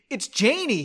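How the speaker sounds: indignant-sounding exclamation; exclaiming. A narrator's reading. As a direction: exclaim it as if it were obvious. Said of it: "he exclaimed".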